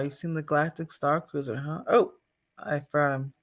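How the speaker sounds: normal talking voice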